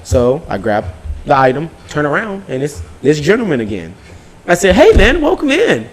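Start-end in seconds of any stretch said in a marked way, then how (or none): 4.8-5.9 high, slow, drawn-out mock-friendly welcome; sweetly over-friendly welcome to a known thief; exaggerated fake-cheery hello; retold pointed greeting. Sarcastic greeting to returning thief.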